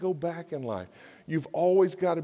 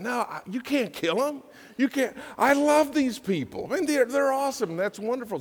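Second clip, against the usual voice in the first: much higher, pleading protective voice; pleading Moses; 10 semitones higher than usual. Acted. Moses begging God to spare the people, much higher. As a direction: beg to spare them.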